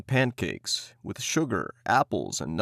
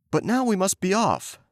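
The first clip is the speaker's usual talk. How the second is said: put-on higher urging boy voice; eager boy; 9 semitones higher than usual. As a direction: hurry his sister. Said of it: "said Hansel".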